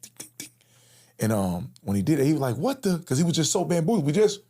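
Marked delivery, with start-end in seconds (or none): 0.0-0.5 soft imitated tick of falling glasses; glasses ticking sound. Recalling the glasses hitting the ground, soft ticks. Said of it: "I could still hear the sound effect". 2.6-3.0 high, startled half-question. Retold or imitated. Victim reacting to being punched, startled.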